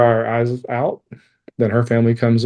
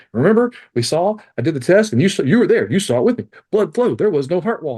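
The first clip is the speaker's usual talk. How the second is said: higher, hurried doctor voice, lightly imitated; frantic doctor; 6 semitones higher than usual. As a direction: rattle it off like a doctor pacing the room. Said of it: "he's a frantic nut".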